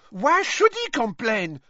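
put-on a high, indignant outcry from a proud young witch; indignant outburst. A narrator's reading. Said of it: "burst out Fleur Delacour, stamping her foot".

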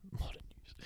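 "Modern news" whispered, breath only; whispered repeat of the slogan. Shown as a demonstration.

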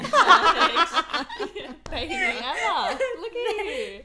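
high laughing tease; giggling, high and loud. Real, not acted. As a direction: laugh through the compliment. Teasing laugh at daughter.

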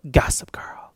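whispered sign-off; whispered Gossip Girl sign-off. Retold or imitated. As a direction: breathe the name out in a whisper. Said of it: "Kristen Bell's Gossip Girl voice says".

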